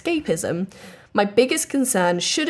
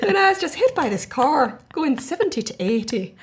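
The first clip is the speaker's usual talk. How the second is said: bad Irish accent; 6 semitones higher than usual. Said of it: "Sorry, I said Irish".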